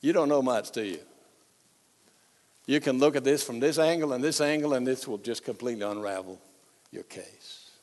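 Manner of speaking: quiet, fast, knowing put-down; condescending expert. Retold or imitated. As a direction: trail off to nothing, smugly. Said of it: "some expert comes up and say".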